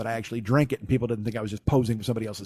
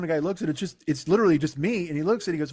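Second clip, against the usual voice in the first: ordinary talk, nothing marked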